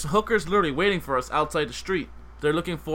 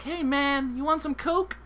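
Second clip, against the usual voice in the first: much higher pushy street hustler; hooker voice; 10 semitones higher, slower than usual. Retold or imitated. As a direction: pitch it up like a dealer offering.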